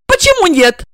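high, loud, tearful and spiteful; tearful sneer. A narrator's reading. Regina taunts Igor, tearful and spiteful, high and loud. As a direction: sneer through tears. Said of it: "слёзы, обида и ехидство".

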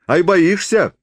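high, teasing question; mocking. A narrator's reading. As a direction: taunt with a smirk. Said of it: "усмехнулся он". (с усмешкой поддразнивает)

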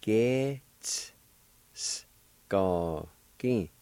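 Ge-ts-s-go-gin very slow, ts and s run together; slow syllable demo. Shown as a demonstration. Teacher modeling Ge-ts-s-go-gin slowly for learners. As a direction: sound out Ge-ts-s-go-gin slowly, holding the ts-s. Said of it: "like this".

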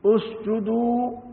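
اسجدوا drawn out as "usjuduu"; damma on the alif. Shown as a demonstration. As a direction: start اسجدوا with a clear "u", slow and stretched. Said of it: "جوار ألف اسجدوا".

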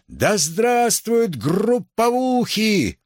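high, drawn-out rallying cry; mock heckler's cheer. A narrator's reading. Heckler interrupting a student meeting, high mocking cheer. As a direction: stretch it out like a jeering slogan.

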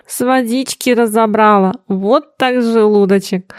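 ordinary delivery, nothing marked

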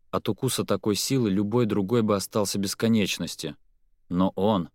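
ordinary delivery

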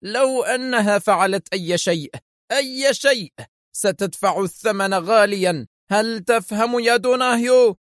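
high-pitched, furious threat; storming exclamation. A narrator's reading. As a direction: rage at your subordinate, pitch soaring. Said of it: "فاستدار إليه ديليشمسكي كالعاصفة هاتفا".

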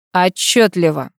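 slow, icy colonel's reply. A narrator's reading. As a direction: let it out slowly with a touch of ice. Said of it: "процедил ледяным голосом полковник". (ледяным голосом)

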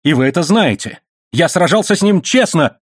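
high-pitched frantic insistence; impassioned, voice climbing high; wild cry. A narrator's reading. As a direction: blurt out your defence wildly. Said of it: "дико крикнул он".

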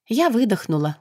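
ordinary delivery

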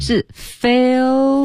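"Phil" said with an open "eh"-like vowel, not "feel". Shown as a demonstration. Teacher correcting pronunciation of Phil, careful. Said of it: "是A音".

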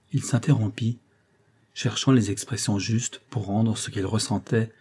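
ordinary delivery, nothing marked

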